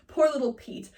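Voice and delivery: usual speaking voice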